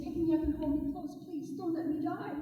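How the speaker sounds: put-on frozen snake begging the boy, soft and pleading. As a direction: beg for your life, quietly. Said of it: "He said".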